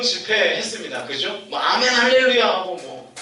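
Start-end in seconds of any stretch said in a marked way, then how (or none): 1.6-2.5 higher, louder hallelujah; imitating a fervent worshipper; fervent amen. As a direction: cry out like at a revival. Imitating retreat congregation.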